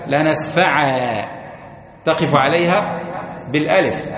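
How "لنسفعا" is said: لنسفعا said slowly, ending on a long alif; pausal alif ending. Shown as a demonstration. Teacher showing the stop on the word, slow and deliberate. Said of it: "لو أردت أن تقف عليها".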